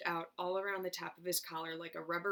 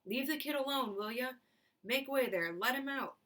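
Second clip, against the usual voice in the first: calling out; 6 semitones higher than usual. A narrator's reading. Shopkeeper clears the crowd.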